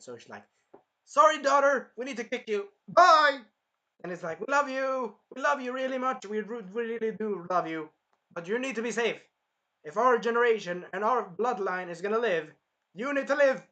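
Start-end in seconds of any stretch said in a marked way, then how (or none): 1.0-3.4 high, loud comic send-off; hammed-up mother's farewell. Mother kicking daughter out to save her, loudly hammed-up. 4.4-13.6 higher, insistent acted plea; pleading parents. Parents urging daughter to save herself, hammed-up pleading.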